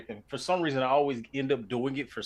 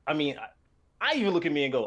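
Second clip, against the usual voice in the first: ordinary delivery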